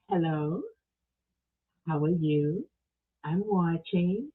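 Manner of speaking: low, soft and drawn out; put-on commenter voice. Host reads Don's watching comment aloud, put-on low voice. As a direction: read it as a deep, slow, quiet greeting.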